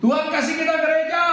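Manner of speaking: high, fast call to the congregation; rallying call. Real, not acted. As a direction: throw it out quickly to draw an amen. Memancing jemaat menjawab amin. (seruan tinggi dan cepat memancing jawaban)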